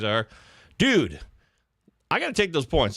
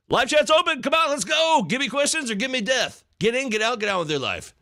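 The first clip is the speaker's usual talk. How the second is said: high, hyped call to the chat; rally the viewers in a raised, ringing voice; pitched-up hype, easing at the end; 9 semitones higher than usual. Real, not acted. Calls chat for questions.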